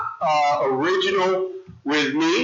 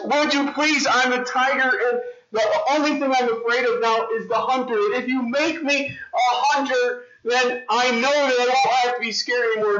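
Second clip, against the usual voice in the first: high, begging tiger voice; pleading tiger; 7 semitones higher than usual. Acted. Tiger begging the wizard to become a hunter, high and pleading. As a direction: beg the wizard one last time. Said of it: "he goes to the wizard and he says".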